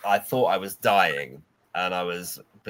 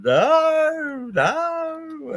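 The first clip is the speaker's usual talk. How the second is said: much higher, wailing no; howling in misery; 5 dB louder than usual. Retold or imitated. Sick Tom howling at Eddie's revolting food talk. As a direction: wail it like you're in agony. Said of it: "howling in misery".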